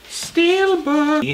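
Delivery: squeaky high hype voice; excited. Real, not acted. Showing off new steelbooks to viewers in a squeaky excited voice. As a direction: sell it like a game-show reveal, way up high. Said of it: "now we got some steel books, baby".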